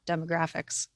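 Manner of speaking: usual speaking voice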